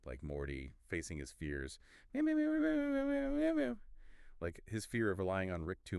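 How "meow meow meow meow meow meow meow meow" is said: very high, squeaked string of meows; rapid cat meowing. Pretend. Cat host on Morty facing his fears, squeaky meowing. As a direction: squeal meows in a quick run.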